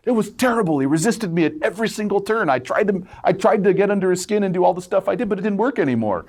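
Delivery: a defeated devil moaning about his failure; whining devil. Retold or imitated. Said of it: "The devil says".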